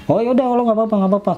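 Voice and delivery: high-pitched imitation of a generous man; acted insistence. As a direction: quote him high, brushing it off. Acted benefactor waving away cost.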